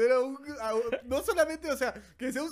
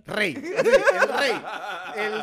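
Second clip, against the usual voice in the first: grand proclamation; 5 dB louder, much slower than usual. Pretend. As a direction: proclaim him king. Mock royal proclamation.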